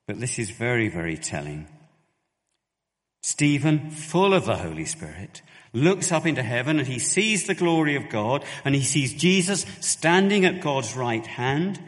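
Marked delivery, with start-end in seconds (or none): 3.2-11.8 much louder and higher, building excitement; preaching at full force. Acted. Preacher building the vision of heaven, loud and high. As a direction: swell into the vision with fervour.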